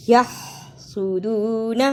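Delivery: یحسدون slow, the ح rasped from the throat; throaty ha. Shown as a demonstration. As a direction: scrape the ح of یحسدون. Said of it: "حلق سے آواز کو رگڑ کر نکالنا". (یحسدون میں حلق سے ح)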